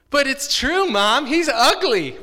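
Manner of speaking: put-on high, loud, protesting child; little boy arguing with his mother; insistent kid. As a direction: whine it like a stubborn little boy.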